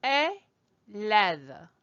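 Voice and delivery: "élève" split in two, é then lève; French élève. Shown as a demonstration. Teacher models the word for pupil, syllable-by-syllable "élève". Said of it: "That's the word that you see here".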